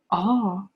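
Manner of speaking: a clear open "Ah"; short ah sound. Shown as a demonstration. Teacher models the ah vowel for the class. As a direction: hold out a plain "Ah" for the class. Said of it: "It's ah".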